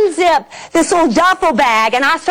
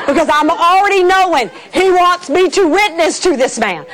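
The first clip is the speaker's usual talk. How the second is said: ordinary talk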